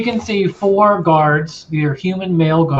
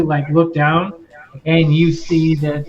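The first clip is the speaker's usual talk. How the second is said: ordinary talk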